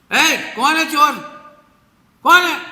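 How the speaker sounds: sharp high shout at a thief; shouting girl. Retold or imitated. Little girl shouting at the cane thief, loud high shout. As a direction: yell out to catch the thief.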